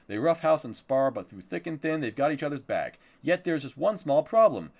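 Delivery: dramatic series-blurb delivery; synopsis read-out. Acted. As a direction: play up the plot summary like a promo.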